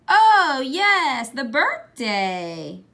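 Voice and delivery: high "Oh", then slow, drawn-out "the birthday"; vague grandma. A narrator's reading. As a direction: play it absent-minded, pretending to forget. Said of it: "Grandma says vaguely".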